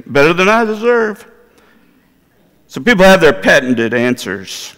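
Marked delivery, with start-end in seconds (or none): 0.1-1.2 lightly mimicked caller, pitched high; imitated callers. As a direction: parrot a catchphrase in a raised voice. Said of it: "they'll say".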